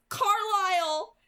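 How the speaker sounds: high, loud, slow call for help. A narrator's reading. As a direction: shout the name across the room. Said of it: "the angel called".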